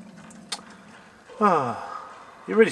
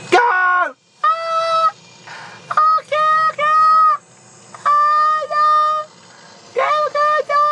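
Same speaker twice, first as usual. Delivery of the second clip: high-pitched panicked shrieking, much higher and louder; panicked screams; 4 dB louder than usual. Real, not acted. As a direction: squeal in real terror as the ghost closes in. Panicking as ghost attacks.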